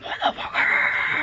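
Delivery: slow harsh metal scream; metal growl. Pretend. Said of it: "everything's gotta be metal".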